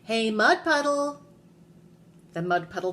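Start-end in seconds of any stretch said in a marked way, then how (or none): 0.0-1.2 high, drawn-out call; loud, stretched challenge; yelling. A narrator's reading. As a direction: call out slowly across the backyard. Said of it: "and yelled".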